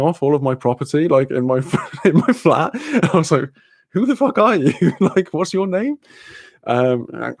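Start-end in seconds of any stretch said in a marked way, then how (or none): 3.9-6.0 rear back at an intruder; incredulous retort. Retold or imitated. Confronting a stranger in her flat.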